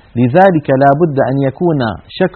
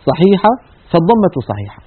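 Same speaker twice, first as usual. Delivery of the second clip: ordinary talk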